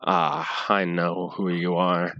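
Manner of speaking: menacing villain. Acted. Villain greets party from above.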